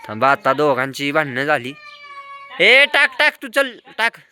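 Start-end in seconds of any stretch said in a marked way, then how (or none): 2.6-4.2 calling out. Real, not acted. Vlogger yells across the terrace at the kid to bowl, high and loud. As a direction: yell it across the terrace, urging.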